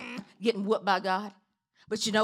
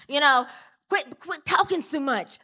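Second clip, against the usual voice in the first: ordinary delivery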